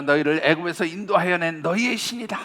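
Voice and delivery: mock proclamation over the golden calf; crowd proclaiming. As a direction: announce it boldly like a rowdy crowd.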